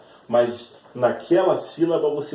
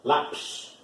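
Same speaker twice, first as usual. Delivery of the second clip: Lápis whispered with no voice; whispered demo; 3 semitones higher than usual. Shown as a demonstration. Teacher whispering an example word to students. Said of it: "olha lá".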